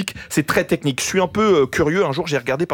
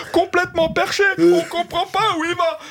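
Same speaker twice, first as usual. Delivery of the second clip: much higher sneering voice of online commenters; mocking commenters; 12 semitones higher than usual. Comic parodies listeners' comments on Radio France, much higher and mocking. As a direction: squeal out the critics' complaints, high. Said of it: "les commentaires sur Radio France".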